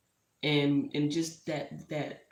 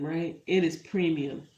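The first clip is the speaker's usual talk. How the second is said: ordinary talk, nothing marked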